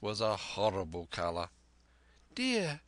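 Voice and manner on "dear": high, drawn-out wail of dismay; distressed mother. A narrator's reading. Mother finds the captain on her floor, wailing in dismay. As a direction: lament in a put-on woman's voice. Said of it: "cried my mother".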